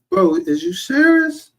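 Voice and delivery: can't believe he's asking this; incredulous. Real, not acted. Incredulous at the jeans question.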